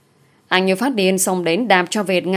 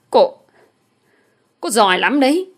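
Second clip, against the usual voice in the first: higher-pitched seething retort; 5 semitones higher, 4 dB louder than usual. A narrator's reading. Humiliated man glaring at his former lover, seething. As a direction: force it out in red-faced anger. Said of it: "Anh ta rít lên".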